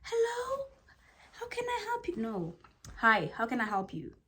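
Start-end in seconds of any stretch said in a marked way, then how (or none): 0.0-2.2 high, soft, meek hello; timid high greeting. Shown as a demonstration. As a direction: shrink into a little voice. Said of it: "As opposed".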